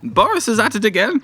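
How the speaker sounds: high-pitched comic royal voice; mock Queen. Pretend Queen complaining about Boris, high mock-royal voice. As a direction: put on a squeaky monarch.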